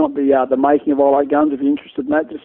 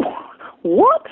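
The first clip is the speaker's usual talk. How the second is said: shocked. Retold or imitated. Shocked reaction in retold story.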